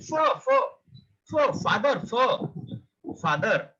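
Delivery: fur and father with a clear F; F sound demo. Shown as a demonstration. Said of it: "pronounce is that F sound".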